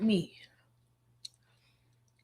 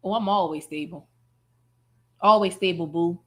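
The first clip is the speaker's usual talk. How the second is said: mocking Marissa; 7 semitones lower than usual. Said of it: "Marissa looked at the chat and said".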